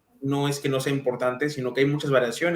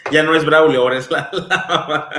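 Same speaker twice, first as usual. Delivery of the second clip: louder, laughing through the words; 2 semitones higher, 7 dB louder than usual. Real, not acted. Host laughing at viewers calling him the mom. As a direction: laugh it out at the mix-up. Joking about being called mom.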